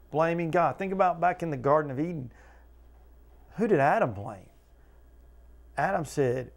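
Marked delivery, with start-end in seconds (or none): none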